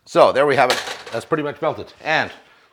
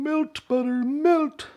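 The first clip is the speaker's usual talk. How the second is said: playful incantation; 14 semitones higher, 3 dB quieter, much slower than usual. Pretend. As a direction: voice sliding up very high, drawn out. Playfully commanding butter to melt.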